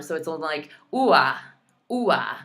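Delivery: usual speaking voice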